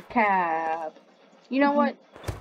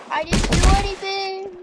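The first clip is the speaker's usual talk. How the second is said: louder whiny denial; loud protest; 9 semitones higher, 5 dB louder than usual. Acted.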